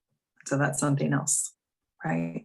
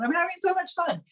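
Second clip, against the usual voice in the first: excited burst; 12 semitones higher than usual. Real, not acted. Describing joyful roller coaster ride.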